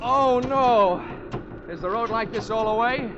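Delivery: high, loud groan of dismay; a jolted passenger's alarmed cry; dismayed outcry. Acted. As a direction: wail at the bad news. Dreading the rough road ahead.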